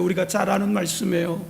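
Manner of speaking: ordinary talk, nothing marked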